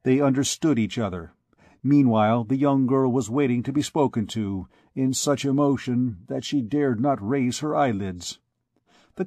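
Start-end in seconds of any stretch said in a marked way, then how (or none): none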